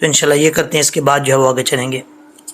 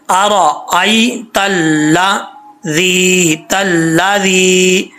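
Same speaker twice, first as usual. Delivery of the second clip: drawn-out Quranic reading of अराअयतल्लज़ी; careful tilawat; slow recitation; much slower than usual. Shown as a demonstration. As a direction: recite it slowly and melodically. Recites corrected word slowly.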